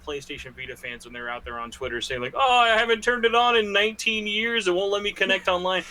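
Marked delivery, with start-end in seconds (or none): 2.4-5.8 high-pitched mock whining; put-on higher voice of a griping user; mocking complaint. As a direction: imitate a fake fan complaining, pitched up. Mimics complaining fake Vita fans online.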